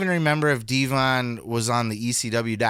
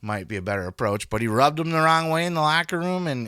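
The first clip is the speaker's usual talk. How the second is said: normal talking voice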